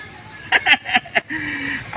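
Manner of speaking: high breathy evil cackle; villain laugh. Acted. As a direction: snicker like a cartoon villain. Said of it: "parang kontrabida yung tawa".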